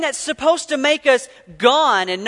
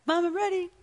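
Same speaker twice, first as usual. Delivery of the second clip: put-on eager child; 7 semitones higher, 7 dB quieter, faster than usual. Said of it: "That's what I did".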